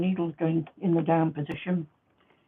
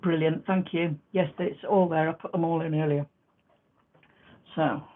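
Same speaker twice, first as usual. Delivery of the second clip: usual speaking voice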